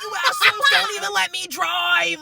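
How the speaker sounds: high-pitched mock outburst; imitated complaint. Quoting Bob's complaint from the film, high mock outburst. Acted quarrel line from the film.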